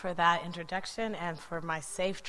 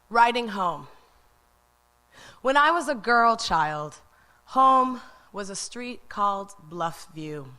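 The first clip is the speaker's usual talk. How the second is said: literary reading; 6 dB louder than usual. Acted. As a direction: present the essay to the audience. Poet begins reading her essay.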